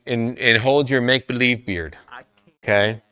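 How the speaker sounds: ordinary talk, nothing marked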